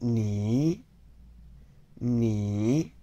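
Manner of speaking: "ni" dipping, then rising; careful dipping Mandarin "ni"; third tone. Shown as a demonstration. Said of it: "that means you".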